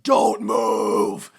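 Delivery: a sudden loud barked order; mock bark. Bodyguard orders the kids to stay put, loud bark.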